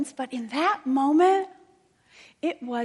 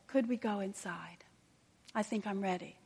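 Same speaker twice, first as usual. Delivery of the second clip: whispered and much softer; soft request; 3 semitones lower, 8 dB quieter than usual. Retold or imitated. Grieving mother quietly asking the chaplain to go inside, whispering. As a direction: hush it out, drained and ready.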